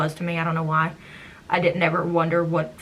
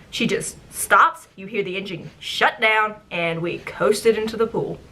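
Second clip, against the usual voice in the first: ordinary delivery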